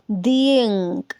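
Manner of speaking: "दी इंक" slow, fully linked; "दी इंक" slowly in one breath; connected demo. Shown as a demonstration. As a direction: join "दी" and "इंक" without a break. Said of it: "पूरा connect करके पढ़ूंगी".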